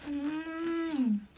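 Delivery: chewing crunchy fish bread; crunching while eating. Real, not acted. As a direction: talk around a crunchy bite. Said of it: "바삭 소리 들리죠?".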